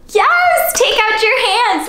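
high, loud cheer to start; cheerful rally. Acted. Host getting kids ready for the song, cheering. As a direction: pump the kids up.

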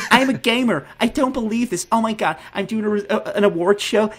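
very high, gushing fake American; mock American accent. Fake gamer gushing over Gary at award show, squealing American accent. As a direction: squeal it like a hammed-up American poser. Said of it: "I keep doing an American accent".

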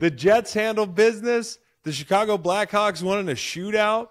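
loud, high, thrilled rundown; excited announcing. Real, not acted. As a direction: proclaim each result at high volume. Excitedly recounts winning bet legs.

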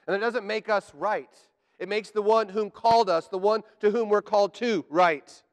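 full-force preaching. Acted. Preacher hammering the truth to his church, full force. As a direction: drive the creed home to the congregation.

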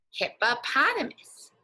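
ordinary talk, nothing marked

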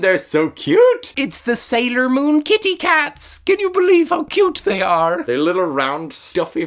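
very high, loud cute-overload squeal; gushing squeal. Real, not acted. Vlogger showing off cute plush cats, high gushing squeal. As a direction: squeal over something adorable.

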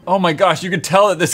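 usual speaking voice

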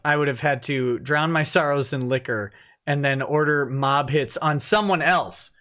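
ordinary talk, nothing marked

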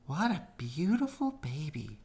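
high, bright-eyed chatter of a magpie; a high bird's delighted cry. A narrator's reading. Magpie cooing over the newborn, high voice. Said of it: "cried a magpie".

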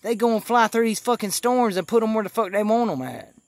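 usual speaking voice

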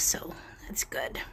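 whispered, so the cat isn't disturbed. Real, not acted. Owner whispering beside the resting cat, soft whisper. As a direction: breathe it out under your breath.

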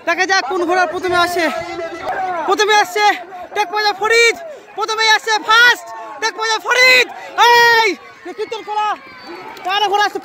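excited high race call, building; race caller. Real, not acted. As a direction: call the finish at full pitch. Live horse race finish calling.

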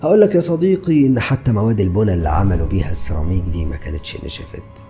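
ordinary delivery

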